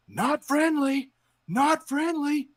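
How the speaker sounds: high, slow, strained warning shout; frantic warning. Retold or imitated. Yelling warning at a kid's dog. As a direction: call it out in rising panic. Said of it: "and I'm saying".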